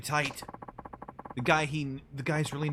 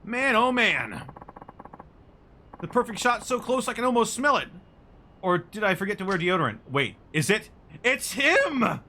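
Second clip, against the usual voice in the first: high, loud, gushing photographer voice; an over-eager paparazzo spotting his big shot; excitable photographer; 8 semitones higher, 6 dB louder than usual. Acted.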